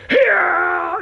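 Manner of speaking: high, loud yelled yeah; mock shout. Gamer imitating what you'd yell under attack, a loud mock shout. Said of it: "you'd be going".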